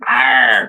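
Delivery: loud high growl of frustration; frustrated cry. Real, not acted. Streamer furious at herself after the spill, angry cry. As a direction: let out a strangled angry cry.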